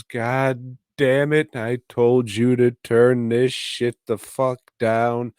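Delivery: a hint of a fed-up cop yelling; angry cop yell. Cop yells at Lee.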